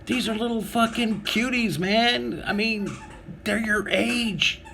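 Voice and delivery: high-pitched disbelieving rant; incredulous inner rant. Retold or imitated. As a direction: scold him like a know-it-all buddy. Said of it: "And I'm like".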